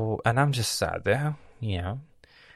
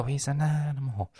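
impressed, approving "He's an animal." with a falling end; admiring praise; 6 semitones higher than usual. Shown as a demonstration. Praising a friend who ate twenty hot dogs, admiring. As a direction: say "He's an animal." as a compliment, fall on "animal". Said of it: "Jimmy ate twenty hot dogs".

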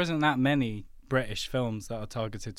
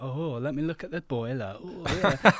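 lightly put-on northern English accent; flirty northern plumber, pitch lifting at the end. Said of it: "like, a bit northern".

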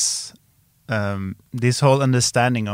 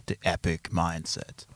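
trailer voiceover; 5 semitones lower, 7 dB quieter than usual. Shown as a demonstration. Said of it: "a movie trailer voiceover".

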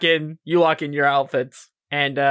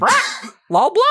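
high, loud parrot squawk; squawking bird; 13 semitones higher, 4 dB louder than usual. Acted. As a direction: shriek it like a pet bird.

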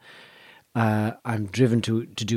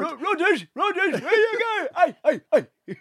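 put-on much higher voice, calling out like a doddery old sea dog; Uncle Albert impression. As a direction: call out as a doddery old man, pitched high. Imitating Uncle Albert after comparison.

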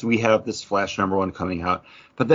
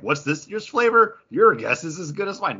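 mock article voice; 8 semitones higher than usual. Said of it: "the first article I clicked on is like".

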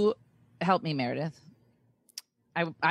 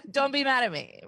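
laughing plea; 10 semitones higher than usual. Real, not acted.

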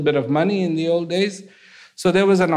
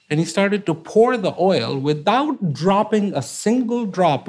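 ordinary talk, nothing marked

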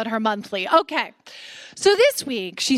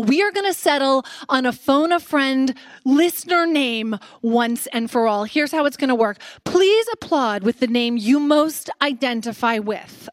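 ordinary talk, nothing marked

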